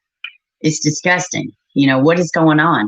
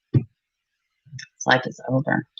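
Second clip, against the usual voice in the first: much softer and slow, sinking away; quiet grief; 6 semitones lower, 8 dB quieter, much slower than usual. Real, not acted. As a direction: let it fade out slowly. Grieving over a dead child.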